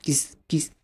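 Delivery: جز said as kis, hissed; voiceless KS. Shown as a demonstration. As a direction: devoice it to k plus s, as in box. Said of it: "أهي شبه بوكس". (انطقها «كس» زي بوكس)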